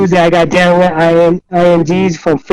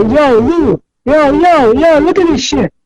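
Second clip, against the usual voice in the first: urgent warning; 7 semitones higher, slower than usual. Retold or imitated. Alarmed warning to friend.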